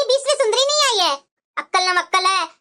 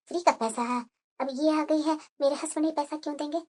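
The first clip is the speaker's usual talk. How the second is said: resentful low aside; 9 semitones lower, 10 dB quieter than usual. Acted. Elder bahu's resentful aside.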